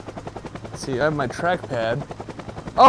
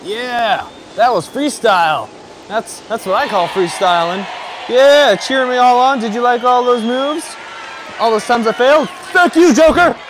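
high, loud showboating to a crowd; mock-triumphant shouting; 8 semitones higher, 7 dB louder than usual. Skier thanking the crowd cheering him on, mock-triumphant shouting. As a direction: play the champion thanking cheering fans.

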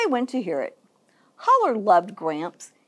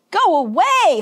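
loud, raised-pitch command; shout; 7 semitones higher, 5 dB louder than usual. A narrator's reading. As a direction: shout the tornado away. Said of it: "Holler shouted at the tornado".

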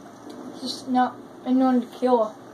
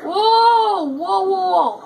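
loud, high cry of alarm; alarmed whoa; 6 semitones higher, 5 dB louder, much slower than usual. Real, not acted. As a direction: call out high and startled to halt things. Alarmed calling out during game.

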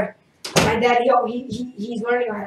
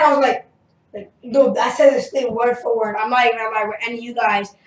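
ordinary delivery

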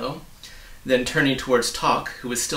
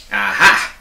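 loud, lower exclamation; officer cutting in triumphantly; triumphant interruption; 3 semitones lower, 13 dB louder than usual. Acted. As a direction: pounce on it. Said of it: "interrupted Officer Shrift".

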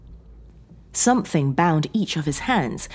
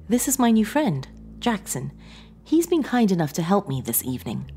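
higher-pitched voice of a composed woman; Sarah, composed captor, pleasantly introducing; 6 semitones higher than usual. Acted. Sarah introducing her new friend to John, composed. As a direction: speak higher, as a politely menacing captor.

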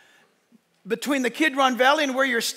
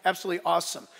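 ordinary delivery

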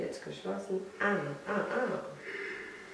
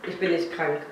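usual speaking voice

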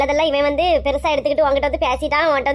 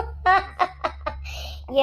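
scornful laugh; 7 dB quieter than usual. Acted. Rival mocks the woman standing against her, with a scornful laugh. Scoffing at Parvathi standing for election.

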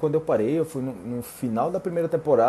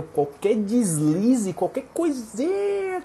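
"deslize" and "coisinha" high and stretched out; exaggerated high emphasis on "deslize", "coisinha"; emphatic; 8 semitones higher, much slower than usual. Real, not acted. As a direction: pitch up and draw out "coisinha" to show how tiny. Stressing that any tiny slip counts.

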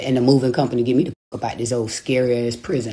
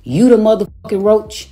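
retold call-out; 6 semitones higher, 5 dB louder than usual. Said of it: "That what I was on her live saying".

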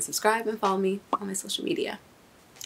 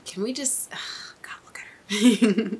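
awed whisper; 4 semitones higher than usual. Real, not acted. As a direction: hush in awe at her.

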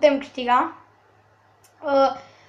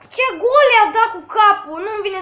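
high, loud amazed question; can't believe that header; amazed exclaim; 6 semitones higher, 5 dB louder than usual. Real, not acted.

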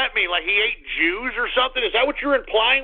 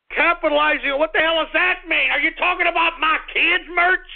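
indignant rant; 10 semitones higher than usual. Real, not acted. Host angrily answering a commenter who insulted his kids' merch. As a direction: fire back at the insult, high and hot. Angry at commenter over kids.

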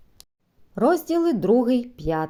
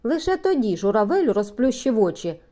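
usual speaking voice

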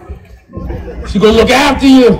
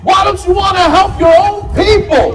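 high-pitched mock yell, no real volume; hammed-up, high-pitched accusing shout; retold shouting; 9 semitones higher than usual. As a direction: imitate an indignant woman yelling across a mall. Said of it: "She started shouting at me in the mall".

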